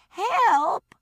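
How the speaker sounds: high, loud cry for help; yell for help. Acted. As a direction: shout it out in panic. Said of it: "the woman yelled".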